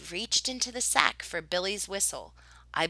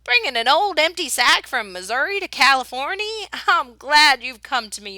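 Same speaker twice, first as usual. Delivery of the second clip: hearty teasing; 6 semitones higher, 8 dB louder than usual. A narrator's reading. Paul teasing Mama.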